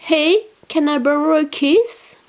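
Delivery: a cute French accent on the English label; playful French-accented English read. Shown as a demonstration. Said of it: "Désolée pour le, euh, mon accent".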